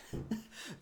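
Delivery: airless high laugh; breathy laugh burst. Real, not acted. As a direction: laugh without voice. Nervous joke to wife on plane.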